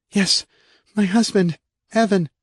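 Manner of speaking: high, anguished woman calling for her husband; a wife frightened for him; distraught wife. Acted. Kay voiced in distress.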